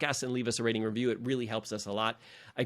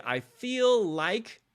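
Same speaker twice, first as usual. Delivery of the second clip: head voice; 7 semitones higher, much slower than usual. Shown as a demonstration. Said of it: "your head voice".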